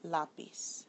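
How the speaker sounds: LÁ stressed in lápiz. Shown as a demonstration. Teacher shows a word that breaks the rule, lápiz stressed on LÁ. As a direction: say lápiz with the weight on its first syllable, LÁ. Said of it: "it's actually on the A".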